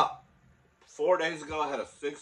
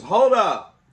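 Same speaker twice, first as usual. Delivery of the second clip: cop calling out; 10 semitones higher, 16 dB louder than usual. Retold or imitated. Cop calls her back.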